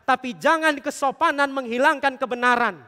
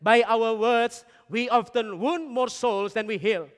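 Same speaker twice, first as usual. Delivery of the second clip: "By our words, we often wound more souls than we heal" read very slowly, word by word; slow solemn quote; much slower than usual. Shown as a demonstration. Preacher quoting Thomas Kempis to the congregation, very slow. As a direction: read the quote as if carving it in stone. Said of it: "Sekali lagi".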